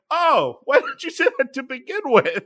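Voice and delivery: very high-pitched imitation of sudden understanding; exasperated scene partner. Scene partner after accent is dropped, mock exasperated realization. Jamie's relieved reply, retold.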